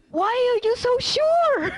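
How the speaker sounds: high-pitched, incredulous retold question; amazed questioning. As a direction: ask it in loud, high disbelief. Retells own amazed question to her.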